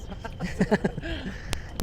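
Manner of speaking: soft, short chuckle; light laugh. Real, not acted. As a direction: laugh quietly at your own remark. Joking about politicians and mate barons.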